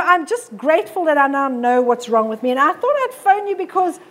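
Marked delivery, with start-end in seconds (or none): none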